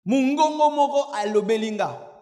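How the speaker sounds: example not to say. Acted. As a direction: call it out as a careless believer would. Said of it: "il faudrait jamais plus dire ceci".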